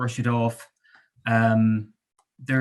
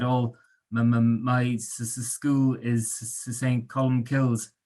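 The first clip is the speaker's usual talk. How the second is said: stuttering; slower than usual. Acted. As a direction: stammer on the s, slow and halting. Narrator stuttering reading in class.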